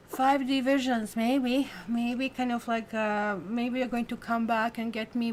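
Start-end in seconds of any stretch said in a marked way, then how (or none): none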